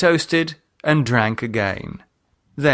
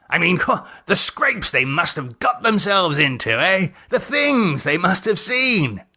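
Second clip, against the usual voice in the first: higher, eager English gent with chuckles; excited Gerald; 5 semitones higher than usual. Acted. Gerald delighted by Lydia's ancestors, excited gent. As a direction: gush over old scandals. Gerald enthuses about the diaries.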